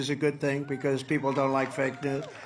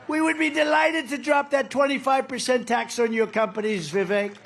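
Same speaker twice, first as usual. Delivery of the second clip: imitating Macron; 8 semitones higher than usual. Macron quoted giving in.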